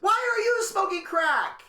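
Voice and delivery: high, disbelieving outburst; appalled. Retold or imitated. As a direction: demand it in disbelief. Reacting to crack offer.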